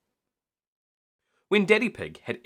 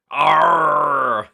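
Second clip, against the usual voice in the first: loud gruff pirate growl; 5 semitones higher, 11 dB louder than usual. A narrator's reading. George playing pirate at lunch, loud pirate arr. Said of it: "in his best pirate voice".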